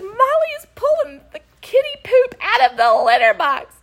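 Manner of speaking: high, loud tattling wail; imitated screaming. Alina reporting the dog's litter box raid, imitated screaming. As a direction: wail the report at top pitch, hammed-up. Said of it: "She's screaming".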